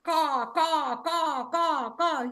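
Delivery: steady run of crow caws; imitated crow call; crow cawing. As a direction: caw like a crow, evenly repeated. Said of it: "The crow looked at the man and said".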